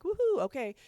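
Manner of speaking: mock-excited whoop to a child; playful cheer. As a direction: cheer like you just knocked the Lego house down. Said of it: "and being like".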